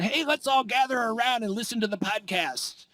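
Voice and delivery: mock nineteen-thirties call to gather round; hammed-up rallying of the family round the radio; old-time radio listener. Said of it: "it's the nineteen thirties".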